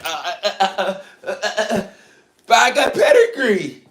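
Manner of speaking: stammering high mock breeder voice, laughing through it. Mocking a breeder who can only stammer about pedigree, high and laughing. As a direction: stutter and laugh, then blurt it out high. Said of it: "He started talking like that".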